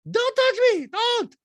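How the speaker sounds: loud, squealed mock protest; Diana. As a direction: recoil in a very high falsetto impression. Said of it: "Diana goes".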